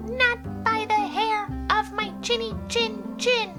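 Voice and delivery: very high piggy voice; high-pitched, emphatic little pig; defiant little pig. Acted. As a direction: refuse in a tiny, high pig voice. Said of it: "the first little pig replied".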